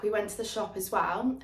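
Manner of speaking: ordinary delivery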